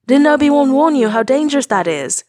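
high, loud and frantic; panicked. A narrator's reading. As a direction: blurt it out in panic. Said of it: "voice high-pitched in panic".

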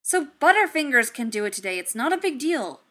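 loud, irritated retort; snapping at the AI; snapped. Acted. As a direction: snap it back dismissively. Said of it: "Tony snapped".

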